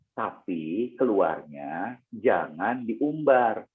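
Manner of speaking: ordinary talk, nothing marked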